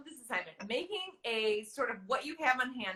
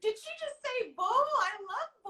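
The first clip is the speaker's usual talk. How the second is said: gushing fan; 9 semitones higher than usual. Retold or imitated. Host imitating a bowl-loving fan, high and gushing. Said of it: "and she's like".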